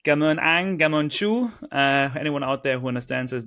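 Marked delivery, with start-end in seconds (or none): 0.0-1.5 loud Vietnamese thanks, "anh" falling, "chú" rising; Vietnamese joke. Shown as a demonstration. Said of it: "Vietnamese joke".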